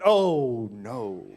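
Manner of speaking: long, loud "oh" sinking low; mock dread. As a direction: groan it out as every guy would, hammed-up. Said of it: "every guy in here went".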